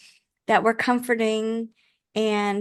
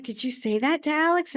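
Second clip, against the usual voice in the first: higher-pitched amazed question; retold question in mild disbelief; blown away; 6 semitones higher than usual. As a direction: imitate an astonished kid asking mom. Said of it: "they were just blown away".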